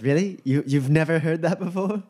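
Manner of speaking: high-pitched disbelieving question; incredulous Duchess. Acted. Duchess asks the naive mage about slang, incredulous. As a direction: question the newcomer in lifted disbelief. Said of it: "And Duchess goes".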